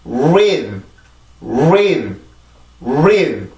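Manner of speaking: "breathe" without its B, starting on a rounded R: "reathe"; drawn-out "breathe" begun straight on R; B left out. Shown as a demonstration. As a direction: drop the B from "breathe". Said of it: "비발음 빼고 발음을 해볼게요".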